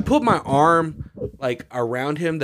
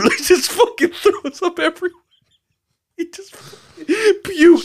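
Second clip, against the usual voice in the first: much higher, laughing through words; 7 dB louder than usual. Real, not acted. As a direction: crack up while telling it. Laughing while telling puke story.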